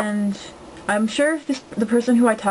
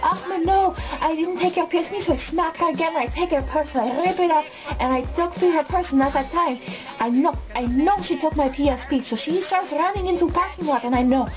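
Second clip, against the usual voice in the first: put-on higher-pitched accented storyteller, in character; put-on accent and raised pitch, telling it as the man himself; Ahmed; 5 semitones higher than usual. Classmate recounting the stolen PSP saga, in a higher accented impersonation. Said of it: "here's the story of Ahmed".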